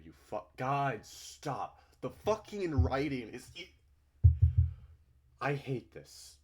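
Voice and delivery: loud exasperated cry at the screen; real frustration boiling over; frustrated outburst. Real, not acted. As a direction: blurt it out, fed up. Streamer frustrated by the game's writing.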